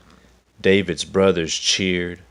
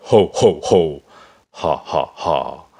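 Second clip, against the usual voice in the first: loud spoken ho ho ha ha; guffawing giant; 8 dB louder than usual. A narrator's reading. Goliath laughing at the boy sent to fight, loud guffaw. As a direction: boom out a mocking guffaw. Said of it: "Goliath guffawed with laughter".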